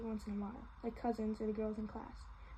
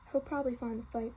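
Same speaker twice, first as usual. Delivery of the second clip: ordinary delivery, nothing marked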